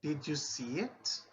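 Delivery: yes-no question with a strong rise; rising yes-no tune. Shown as a demonstration. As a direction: pitch swoops up on "it". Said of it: "see and listen how they are, appropriately intonated".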